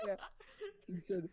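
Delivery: helpless high laughter through the words; real laughing. Real, not acted. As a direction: speak while cracking up. Cracking up at the retold story.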